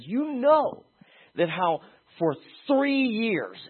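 impassioned Paul quote. Acted. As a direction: plead it like Paul to his elders.

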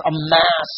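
"amass" lifted and stretched as the key word; emphasized word. Shown as a demonstration. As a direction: stress "amass" with a slow fall. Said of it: "I emphasize".

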